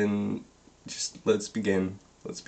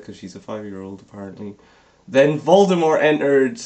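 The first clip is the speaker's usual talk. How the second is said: ordinary delivery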